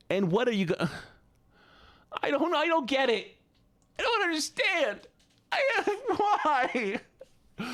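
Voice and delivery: very high, tearful squeal; mock wailing confusion. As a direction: squeal it like a hammed-up crying fit. Mock meltdown, cannot understand the submission.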